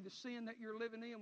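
ordinary delivery

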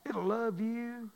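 slow, tender, drawn-out; slow tender repeat. Acted. Pastor reassuring the congregation, slow and tender. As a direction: let it sink in gently. Repeated line, second slowed.

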